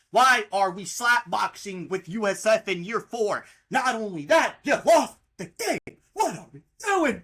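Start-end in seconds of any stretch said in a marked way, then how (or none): 3.7-7.2 loud, slowed-down shout; angry shout. Real, not acted. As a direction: hammer the point home. Shouting at coach.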